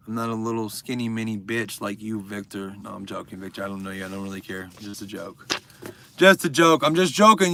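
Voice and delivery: normal talking voice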